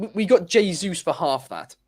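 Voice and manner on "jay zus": "Jesus" said as JAY-zoos; Jay-zoos pronunciation. Real, not acted. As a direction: start "Jesus" with a J, long ay, ending zoos. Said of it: "Why are you saying Jesus like that?".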